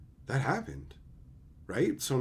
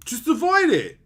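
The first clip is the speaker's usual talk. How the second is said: high, loud sneering mimic of the advice; mocking whiny voice; 10 semitones higher, 7 dB louder than usual. Mocking the players who say just avoid it, high and loud. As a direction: parrot them high and loud.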